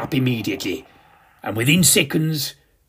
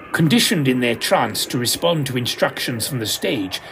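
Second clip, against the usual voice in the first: ordinary delivery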